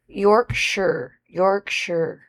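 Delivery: "Yorkshire" said slowly as YORK-shuh, testing the sound; careful Yorkshire. Shown as a demonstration. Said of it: "now that you call me out on my accent".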